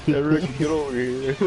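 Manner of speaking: high giggly cackle; giggling. Real, not acted. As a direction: giggle through the line. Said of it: "Stop laughing like this".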